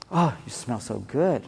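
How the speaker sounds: low, soft, slow murmur; intimate compliment. Retold or imitated. Husband hugging wife, low soft compliment. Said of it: "You give her a hug".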